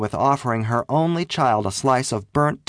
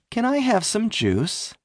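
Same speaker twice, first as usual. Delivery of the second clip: politely; 10 semitones higher than usual. A narrator's reading. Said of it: "I asked politely".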